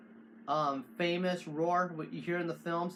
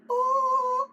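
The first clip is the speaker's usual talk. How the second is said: high held opera-style oh; opera-style roar tone; operatic oh; 7 dB louder than usual. Shown as a demonstration. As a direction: sing it like an opera note. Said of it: "remember how you sing opera".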